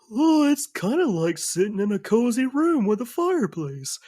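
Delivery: very high, cheery bear sidekick; cartoon bear. Bear describes the cozy inside, high cartoon voice. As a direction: pitch it way up, Yogi-style. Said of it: "The bear looks at you and goes".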